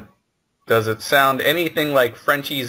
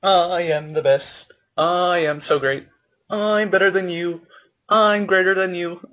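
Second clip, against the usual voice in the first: higher-pitched sing-song bragging; mock boasting; 6 semitones higher than usual. Bragging as better than everybody, mock boastful. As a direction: gloat in a put-on, higher voice. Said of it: "A stereotypical French accent".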